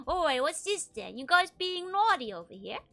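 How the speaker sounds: put-on teasing the monsters in a British accent. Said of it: "Pure British".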